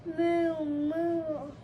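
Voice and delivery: mild mooing; a lightly voiced cow; cow's moo. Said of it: "who muttered".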